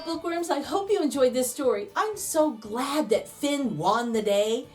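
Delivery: usual speaking voice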